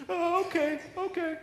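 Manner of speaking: high reluctant agreement; grudging girl. Retold or imitated. Girl grudgingly agrees to pray nightly. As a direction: give in with a sigh. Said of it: "girl reluctantly agrees".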